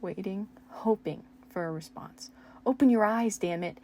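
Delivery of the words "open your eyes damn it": loud angry plea; desperate yell. Acted. Steve shaking Eddie awake, loud desperate yell. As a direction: force it out in despair.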